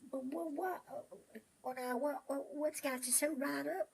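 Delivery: high flustered what-what; startled. Acted. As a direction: caught off guard and flustered. Miner startled by horse.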